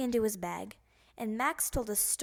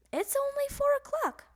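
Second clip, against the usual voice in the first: much higher, held incredulous; surprised protest; 4 dB louder than usual. Acted. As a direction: sound amazed.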